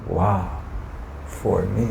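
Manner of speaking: hushed awe. Real, not acted. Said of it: "hushed marvel".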